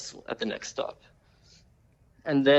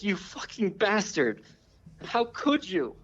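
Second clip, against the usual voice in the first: raised pitch, playing an offended woman; mock outraged woman; 9 semitones higher, slower than usual. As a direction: imitate a woman's scandalized outburst. Retold woman cursing the man.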